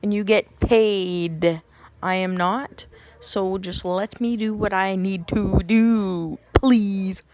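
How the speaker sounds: slow, emphatic, drawn-out comeback; mock exasperated retort. Labouring woman pleading with the nurse who scolded her, mock exasperated.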